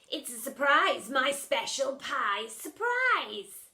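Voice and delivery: sly villain. Acted. Splat sly.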